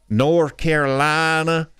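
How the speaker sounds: lightly put-on North Carolina twang; a hint of North Carolina drawl, slowed; mild North Carolina accent demo. Shown as a demonstration. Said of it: "people talk like this in North Carolina".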